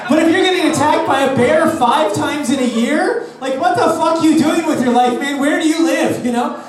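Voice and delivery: what-the-hell rant at a bear victim; incredulous rant. Acted. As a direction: yell at them in disbelief.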